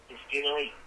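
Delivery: normal talking voice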